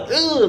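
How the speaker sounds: high-pitched grossed-out "ugh"; mock cringing groan. Trainer seeing the finger, imitated cringing groan. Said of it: "like, they cringe or whatever".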